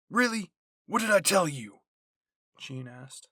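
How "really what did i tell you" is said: exasperated scold. Acted. Jean catches Sasha stealing bread.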